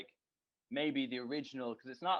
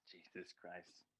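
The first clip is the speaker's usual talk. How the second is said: normal talking voice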